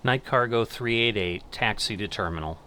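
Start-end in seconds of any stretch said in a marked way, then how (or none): none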